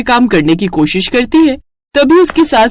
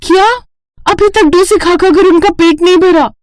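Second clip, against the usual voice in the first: outraged disbelief; 10 semitones higher than usual. Acted. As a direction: burst out in disbelief. Bride outraged at more orders.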